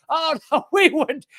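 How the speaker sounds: high, laughing mock innocence; mocking denial. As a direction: scoff at their fake innocence. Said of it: "to say".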